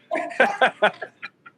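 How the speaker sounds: a sudden high laugh; high burst of real laughter. Real, not acted. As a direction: laugh out loud at how bad it is. Laughing at Saint Anger.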